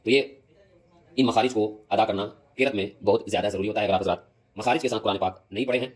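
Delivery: normal talking voice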